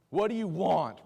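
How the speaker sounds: loud, high, suspicious challenge. Retold or imitated. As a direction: demand it of a too-friendly stranger. Suspicious reaction to a kind stranger.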